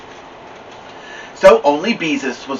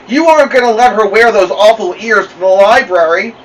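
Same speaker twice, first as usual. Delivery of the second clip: protesting; 8 semitones higher, 8 dB louder than usual. A narrator's reading. Beezus protesting to Mother.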